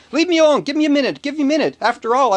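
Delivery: high, loud protest; a grieving widow in line yelling at the woman nudging her; snapping back. Retold or imitated. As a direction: cry out, leave me alone. Widow snaps at Tracy.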